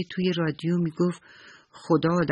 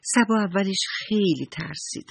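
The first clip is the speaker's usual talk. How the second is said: plain reading voice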